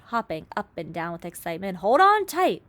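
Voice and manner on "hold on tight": excited shout. A narrator's reading. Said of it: "shouted the old green grasshopper".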